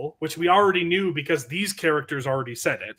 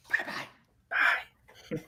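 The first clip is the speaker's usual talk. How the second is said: whispering; 6 dB quieter, much slower than usual. Real, not acted. Saying bye for pet.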